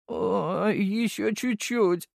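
high, drawn-out sleepy groan; strained, high-pitched grunting plea; groaning deputy. A narrator's reading. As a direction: moan it dreamily in a thin high voice. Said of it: "закряхтел Борзов".

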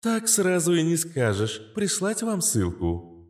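bright high cheerful voice, slow; cheerful helpful assistant voice. Acted. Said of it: "прозвучал весёлый голос".

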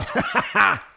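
loud gleeful mocking laugh. A narrator's reading. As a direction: laugh in his face. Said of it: "рассмеялся сир Берси". (Берси громко и злорадно смеётся)

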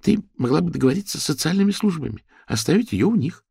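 ordinary delivery, nothing marked

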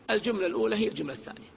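normal talking voice

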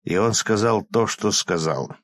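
usual speaking voice

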